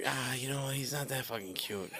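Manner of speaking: low, very soft mock aside; hushed imitation of an honest opinion; reluctant verdict. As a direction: murmur it, as if sparing the parents.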